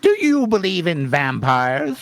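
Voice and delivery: high start, sliding grandly down; portentous cartoon coyote question; Wile E. Coyote. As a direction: start high and glide down dramatically. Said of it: "I'm gonna be like the Wi-, Wile E. Coyote voice".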